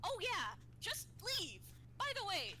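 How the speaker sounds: sarcastic. Acted. Sarcastic in-character outburst.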